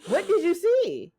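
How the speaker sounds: incredulous repeat. Real, not acted. Friend asking Mariah in disbelief, high and slow.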